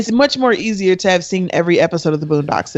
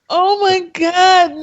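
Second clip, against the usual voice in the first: drawn-out, high Oh my God; dramatic disbelief; 13 semitones higher, 4 dB louder, slower than usual. Real, not acted. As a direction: stretch it out in mock despair. Dreading the deciding vote.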